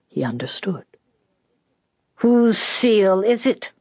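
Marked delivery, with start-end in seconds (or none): 2.2-3.7 put-on high, drawn-out old man's demand; demanding graybeard. A narrator's reading. Graybeard questioning the old woman, demanding. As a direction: demand it slowly, pitched high, rising at the end. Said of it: "demanded one of the graybeards".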